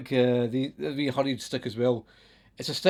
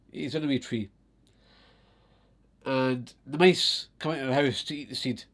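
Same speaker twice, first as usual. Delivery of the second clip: usual speaking voice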